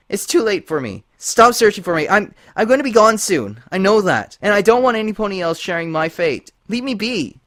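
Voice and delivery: high, loud, anguished warning; high, loud, desperate unicorn; despairing Twilight. A narrator's reading. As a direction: push them away in fear.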